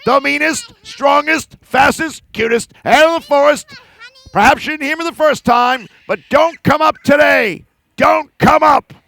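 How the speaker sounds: loud, puffed-up bear bragging and warning; big blustering bear voice; boastful bear. Acted. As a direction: boom it out like a bully bear.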